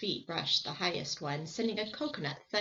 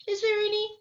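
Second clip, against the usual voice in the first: much higher and louder; eager; 8 dB louder, faster than usual. Acted. Boy asking for food, high and eager. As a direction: blurt it out hopefully. Boys interrupting each other.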